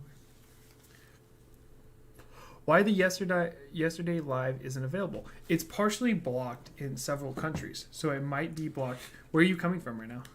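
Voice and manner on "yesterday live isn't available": normal talking voice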